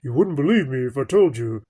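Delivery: a hint of a warm movie narrator; lightly imitating a film's opening narration; mild mock narrator voice; bassy narrator. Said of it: "that really bassy, warm narrator's voice".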